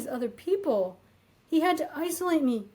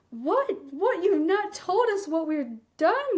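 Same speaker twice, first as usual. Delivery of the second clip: high, incredulous what-what; baffled disbelief; 6 semitones higher than usual. Retold or imitated. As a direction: replay your total confusion, pitch up. Her baffled reply to boss.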